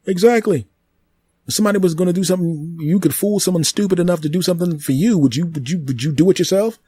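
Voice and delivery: a living tree explaining itself to the human, high and smug; talking tree. Acted. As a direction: play the tree, smug and amused. Tree character argues.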